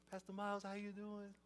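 put-on small, high, soft child voice; high, quiet kid's greeting; little kid. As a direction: imitate a shy-soft five-year-old. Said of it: "this little kid comes up to me".

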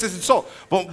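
ordinary talk, nothing marked

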